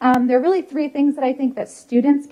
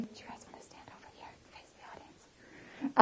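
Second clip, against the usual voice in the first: whispered aside; 19 dB quieter than usual. Real, not acted. Aside to students during talk.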